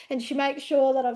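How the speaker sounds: ordinary talk, nothing marked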